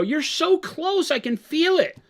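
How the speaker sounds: high, drawn-out mimicry of a co-host; mocking quote. Host throws co-host's words back at them, high mocking voice. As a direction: draw it out high, sending up the other person. Mimicking what the other said earlier.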